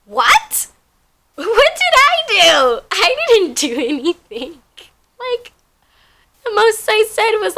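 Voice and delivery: hammed-up wounded innocence; mock offended. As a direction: play innocent and outraged. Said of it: "mock-defensive self".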